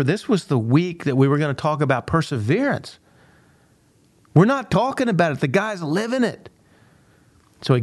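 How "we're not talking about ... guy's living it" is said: much higher, driving the point home; emphatic punchline. Real, not acted. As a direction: land the lesson with real fire. Speaker drives home the lesson.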